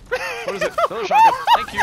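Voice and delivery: very high, loud frustration; exasperated high yelp. Real, not acted. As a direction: squeal it in disbelief. Disappointed by mystery box.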